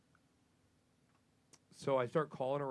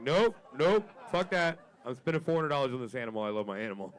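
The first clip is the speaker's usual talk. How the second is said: exclaiming; 4 semitones higher, 7 dB louder than usual. Retold or imitated. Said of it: "I'm like".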